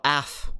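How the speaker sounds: "af" said as one syllable; read as a word. Shown as a demonstration. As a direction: sound out "af" as a single short word, not two letters. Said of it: "'Cause you can't go".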